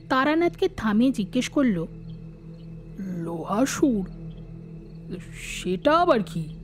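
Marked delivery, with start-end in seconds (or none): 3.0-6.6 high, wondering question, name drawn out; puzzled interruption. Acted. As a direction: break into the tale, baffled by the name. Listener interrupts the tale, puzzled. (অবাক কৌতূহলী প্রশ্ন)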